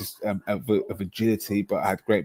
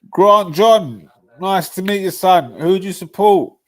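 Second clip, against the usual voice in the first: higher, louder hammed-up host voice; mock TV presenter; 8 semitones higher, 8 dB louder than usual. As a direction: greet him like a cheesy TV interviewer. Mock pundit greeting a fan.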